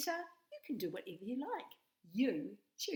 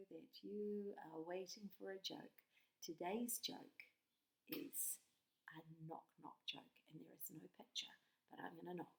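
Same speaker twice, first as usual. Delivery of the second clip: hushed; 9 dB quieter than usual. Acted. Building suspense before joke.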